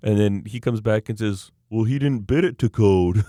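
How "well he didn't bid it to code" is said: loud, dim-witted contractor voice; mocking the contractor. Telling a friend, mocking the contractor's dumb excuse, loud put-on voice. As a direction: ham up the moron's line. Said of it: "that's actually how he talks".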